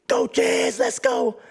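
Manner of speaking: high, loud cheer; cheering call. Retold or imitated. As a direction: shout encouragement across the room. Friend cheering from the back.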